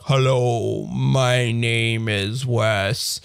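very slow, dragged synthetic voice; slow robot voice. Retold or imitated. Showing the speech rate turned way down, slow robot voice. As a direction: stretch each word like a slowed-down machine. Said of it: "then you can do it really like".

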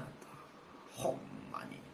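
unvoiced whisper behind his back; a breathy aside under the breath. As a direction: hide the grumble from the guest. Muttered aside about Take.

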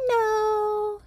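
put-on a tiny girl's bright no; little girl. Daughter answering dad, very high childlike voice.

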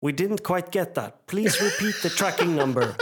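mock the machine while laughing; robot voice. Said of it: "they were like".

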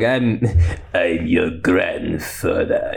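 low, slow villain impression; Palpatine. Palpatine revealing himself to Rey, low slow villain. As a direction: drag it out low like a sinister old emperor. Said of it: "like, Palpatine and Rey. He's like".

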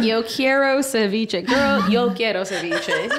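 slow mock Cuban Spanish, laughing; imitating Cuban accent. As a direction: tease her accent switch. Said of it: "changed her accent".

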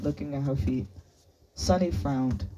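plain reading voice

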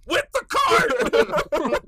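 loud, high, drawn-out yell; panicked shout. Retold or imitated. Calling to Drea across the store, a drawn-out panicked shout. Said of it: "I went".

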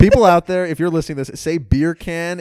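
loud, high, through laughter; laughing appeal. Real, not acted. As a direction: call to listeners while cracking up. Addresses listeners while laughing.

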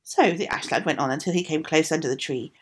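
usual speaking voice

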